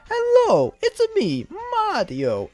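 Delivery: very high-pitched falsetto cartoon plumber; exaggerated, high video game mascot voice; Charles Martinet's Mario. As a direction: squeal the greeting in a sky-high impression. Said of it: "He was like".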